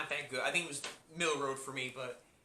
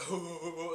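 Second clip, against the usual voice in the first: Seth Rogen weed laugh; 4 dB quieter than usual. Retold or imitated. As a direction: imitate a stoner's huh-huh laugh. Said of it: "never seen Donkey Kong do that".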